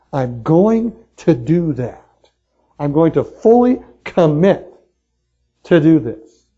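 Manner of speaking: slow, deliberate and determined; firm commitment. Shown as a demonstration. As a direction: plant your feet and promise it. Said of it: "it's not like".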